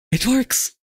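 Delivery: high, rising squeals of disbelief; can't believe it actually worked; thrilled high-pitched "it works"; excited disbelief. Real, not acted. Software test suddenly succeeds.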